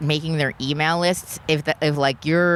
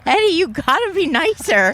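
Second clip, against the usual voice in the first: much higher, louder squeaky tease; mock high voice; 13 semitones higher, 4 dB louder than usual.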